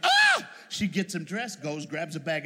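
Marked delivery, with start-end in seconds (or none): 0.0-0.5 high, loud shriek of shock; a mother's horrified shriek; shocked scream. Retold or imitated. Mama seeing her naked son, loud shriek. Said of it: "Mama's like".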